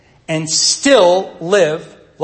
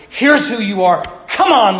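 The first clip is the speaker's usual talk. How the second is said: raised, louder urging to get going; rallying exhortation; 5 semitones higher, 4 dB louder than usual. Acted. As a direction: punch it out like a pep talk. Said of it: "Right in the middle is that reminder".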